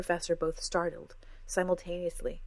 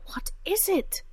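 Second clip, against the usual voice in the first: high, sharp demand; 14 semitones higher, 3 dB louder, slower than usual. A narrator's reading. Cora demands what is wrong, high and sharp. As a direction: insist on an answer. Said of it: "Cora demanded".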